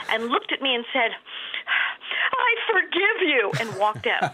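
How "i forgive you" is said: drawn-out, high mock-furious quote; imitating seething anger. Guest imitating an angry fan at an autograph show, seething. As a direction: stretch it out slowly as an angry fan, hammed up. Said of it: "looked incredibly angry".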